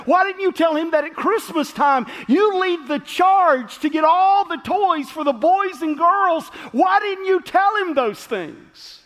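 a husband's exasperated scolding, rising to a yell; upset husband. Retold or imitated. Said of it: "her husband got upset".

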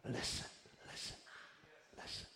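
hushed, voiceless 'listen'; whispered urging. Acted. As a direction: whisper it, pausing between each word. Demonstrates God's whisper.

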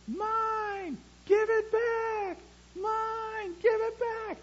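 put-on very high, shrill little-kid yell of mine and give it back; toddler screaming. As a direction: scream it like a small child fighting over a toy. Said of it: "I hear this screaming".

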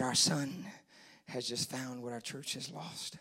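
very low, soft and solemn throughout; grave, quiet quote; hushed repeat. Retold or imitated. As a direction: say it almost under the voice. Same line repeated in hush.

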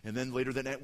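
ordinary delivery

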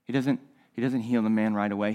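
ordinary talk

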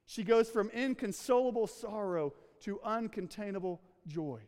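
ordinary talk, nothing marked

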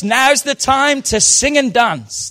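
loud, high Scottish mum voice; rousing wake-up call in a Scottish accent. Retold or imitated. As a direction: imitate a Scottish mother, slow and lilting. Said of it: "she would say in her Scottish voice".

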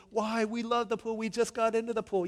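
put-on high, loud kid complaint; protesting children. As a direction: imitate kids objecting to leaving. Children protest leaving the pool.

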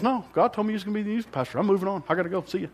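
brisk, high, offhand pastor voice; lightly voiced pastor hurrying away; Royce impression. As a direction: breeze through it like he's already walking off. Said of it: "that's a good Royce impersonation".

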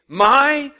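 high, loud furious bark; furious demand. A narrator's reading. Training officer furiously demanding of the boy. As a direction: demand it in fury. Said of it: "he demanded furiously".

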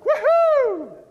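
a sarcastic, hammed-up cheer; mock kids cheering.

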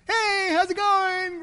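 high, drawn-out, hammed-up hello; mock cheery greeting. Preacher showing what grumblers don't sound like, mock cheery. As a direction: sing out an over-friendly greeting. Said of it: "Grumbling people aren't like".

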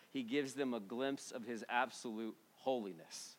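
ordinary talk

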